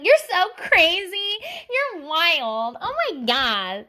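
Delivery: high, slow, gushing exclamation; hammed-up amazed reaction; mock amusement. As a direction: imitate tickled friends, stretching the words. Imitates people laughing at her.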